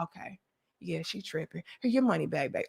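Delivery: low, soft aside; hushed, unbothered mock reply; confiding manager. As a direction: imitate a manager quietly placating people. Manager quietly siding with her, refunding.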